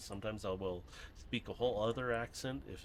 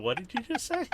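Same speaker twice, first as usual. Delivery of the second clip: higher, louder mock-baffled question; retold bafflement; 13 semitones higher, 7 dB louder than usual. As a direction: act out being thrown by it. Acting out baffled reaction to fast talk.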